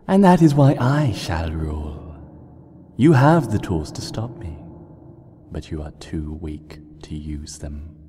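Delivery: cold gloating, sinking to a whisper; smiling menace. Acted. Nightmare Moon threatening Celestia, gloating whispered menace. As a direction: purr the threat with a smile. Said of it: "it smiled".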